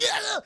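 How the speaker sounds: high, loud cry of pain; mock electric-shock scream; tased yell. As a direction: yell as if hit by a taser. Imitates man being tased.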